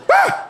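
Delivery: sudden high yelp of pain; mock pained shriek; retold scream. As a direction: yelp like your back just caught fire. Man catching fire screams.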